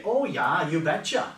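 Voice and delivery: a light Upper Midwest Minnesota accent; chirpy Minnesota "you betcha". Shown as a demonstration. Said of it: "we say".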